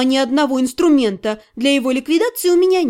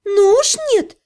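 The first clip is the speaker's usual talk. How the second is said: indignant; 7 semitones higher than usual. Acted. Indignant refusal in danger.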